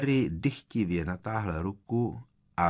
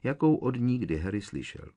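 ordinary delivery, nothing marked

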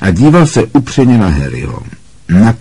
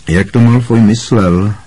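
ordinary delivery, nothing marked

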